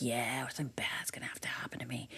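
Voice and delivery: 속삭이듯 나지막이; whisper. Retold or imitated. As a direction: breathe it out like a secret. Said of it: "And we're like".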